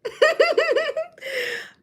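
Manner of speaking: squealing high laugh. Real, not acted. Laugh after song title.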